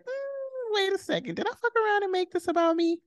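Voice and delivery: high-pitched second-guessing of oneself; mock realization. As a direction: squeak it out as a sheepish dawning thought. Acting out a dawning realization.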